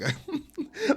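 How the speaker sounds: breaking into a high laugh mid-sentence. Real, not acted. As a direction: let laughter interrupt the words. Laughs at his own wish.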